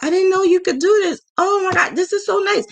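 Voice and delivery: high, gasping gush of amazement; imitating the ladies' thrilled reaction; delighted surprise. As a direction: gush like you just saw something wonderful. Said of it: "and they were like".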